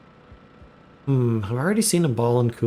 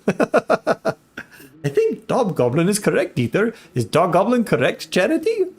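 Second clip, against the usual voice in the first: higher, hammed-up German accent; cartoonish German accent, pitched up; 5 semitones higher than usual. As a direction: put on a lilting mock German voice. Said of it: "German accent".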